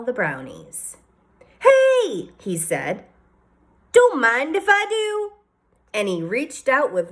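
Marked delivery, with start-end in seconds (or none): 1.6-2.3 excited. Acted. Wendell spots the brownies. 3.9-5.3 cheerful man voice. Acted. Wendell takes a brownie.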